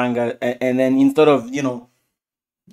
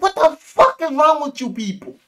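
pitched up, louder disbelieving yell at them; half-mock outrage, higher and louder; exasperated outburst; 10 semitones higher, 4 dB louder than usual. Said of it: "Blackthorn's like".

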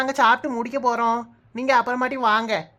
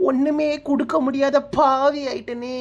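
slow, hammed-up weepy lament; tearful; slower than usual. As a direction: wail it with long dragged vowels. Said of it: "அத நினைச்சுதான்க்கா அழுறேன்". (மிகையாக அழுது புலம்புவது)

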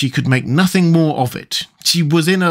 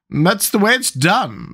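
slow, high, decisive pronouncement; great decision; 7 semitones higher, slower than usual. A narrator's reading. Queen answering Alice with great decision. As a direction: state it firmly, drawing it out. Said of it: "said with great decision".

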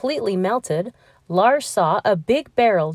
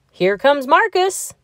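higher, louder call announcing the eagle; 8 semitones higher, 4 dB louder than usual. Acted. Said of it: "at last he called".

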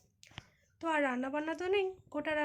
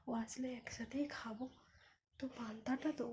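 whisper; 7 dB quieter than usual. Real, not acted. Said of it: "বাবু যেহেতু ঘুমাচ্ছে".

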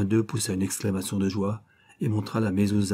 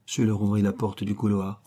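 usual speaking voice

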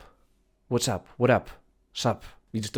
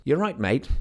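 louder British greeting to a mate; British "you alright, mate"; 7 semitones higher, 5 dB louder, slower than usual. Shown as a demonstration. Teacher demoing how British friends greet each other in British accent. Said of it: "bardzo brytyjska".

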